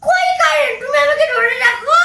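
high-pitched, wailing protest; distressed protest. Acted. Child answering panicking mother, distressed protest.

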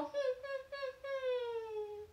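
high whimpering hmm like a puppy; puppy whine. Shown as a demonstration. Teacher showing the kids a puppy's whine, high whimper. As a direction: whine high through the nose like a sad pup. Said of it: "What noises do puppies make".

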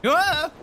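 loud, high "ah" cry; startled scream. Real, not acted. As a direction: scream as if caught off guard. Startled during boss fight.

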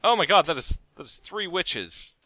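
high, rattled outburst; alarmed. Real, not acted. Player realising there are three witches, alarmed. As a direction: blurt it out in mounting alarm.

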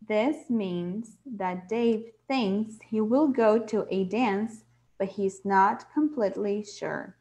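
slow, deliberate textbook reading; slow reading aloud. Shown as a demonstration. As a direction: read the explanation slowly. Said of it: "diz o seguinte". (leitura lenta do livro)

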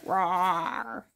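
soft, raspy play roar; lion roar. Shown as a demonstration. Parent showing toddler lion sound, soft roar. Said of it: "What's a lion say?".

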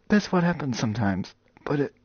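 ordinary talk, nothing marked

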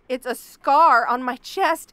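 pained outburst. Acted. Upset at being called beautiful.

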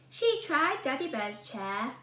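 plain reading voice

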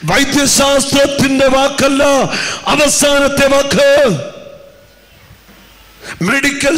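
high, loud, slow preaching proclamation; full-force preaching. Acted. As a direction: thunder it out from the pulpit, drawn out. Preacher proclaims over music. (ഉച്ചത്തിലുള്ള പ്രസംഗ ശൈലി)

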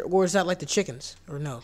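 ordinary talk, nothing marked

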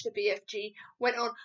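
ordinary delivery

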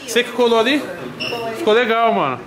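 loud, pumped-up vlogger shout; hyped gym vlog. Acted. Vlogger shouting to followers, loud and pumped. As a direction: hype the camera after the pre-workout.

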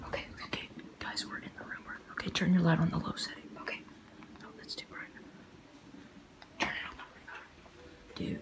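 soft sneaky whisper. Real, not acted. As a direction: whisper so nobody wakes. Inside sleeping friend's room.

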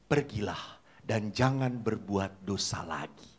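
whispered slowly, gentle and unvoiced; hushed whisper. Jesus tells the forgiven woman gently, whispered. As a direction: breathe it out like a soft blessing.

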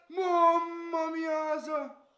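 drawn-out high anguished cry; anguished groan. Real, not acted. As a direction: stretch it out in dismay. Fan groaning at a near miss.

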